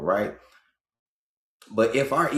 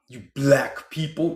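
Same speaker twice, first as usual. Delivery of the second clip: put-on African accent; 4 semitones higher than usual. Mocking an African saying it, slow African accent. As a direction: drag it out like an African speaker. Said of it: "Don't even say that no more".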